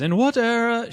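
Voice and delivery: high, loud imitation of an Australian quiz host; Australian accent. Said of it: "She had an Australian accent".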